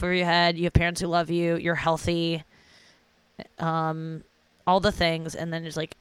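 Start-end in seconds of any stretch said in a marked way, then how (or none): none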